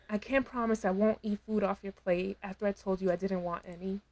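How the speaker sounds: normal talking voice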